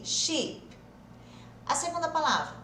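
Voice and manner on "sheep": Sheep with a long, stretched "ee"; exaggerated long vowel. Shown as a demonstration. Teacher exaggerates so students can identify it, long ee. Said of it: "tem som de I".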